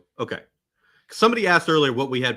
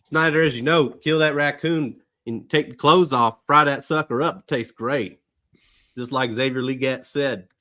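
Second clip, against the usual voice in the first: put-on Southern country accent; 2 semitones higher, 4 dB louder than usual. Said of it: "That's a pretty good accent".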